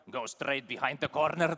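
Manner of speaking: put-on a thick East European accent giving directions. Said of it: "East European accent".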